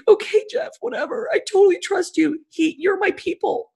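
tiny, very high little voice; mock-tearful squeak of total trust; small high-pitched surrender; meek, trusting. Retold tearful trusting reply to therapist.

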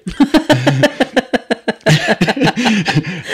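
big loud burst of laughter; hearty laugh. Real, not acted. Laughing loudly while telling the interviewer about the job. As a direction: laugh out loud at your own line. Laughing at his own story.

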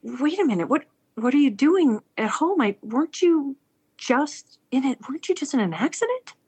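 wife finding husband home, baffled; baffled surprise. Retold or imitated. Wife finding husband unexpectedly home, baffled. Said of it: "And she said".

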